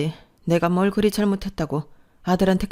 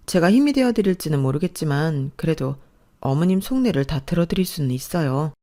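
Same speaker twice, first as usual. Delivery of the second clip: usual speaking voice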